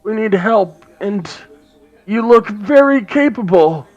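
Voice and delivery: high, loud raspy dwarf woman; [name]'s raspy voice. Acted. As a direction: rasp it out as a gruff dwarf. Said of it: "in a raspy voice".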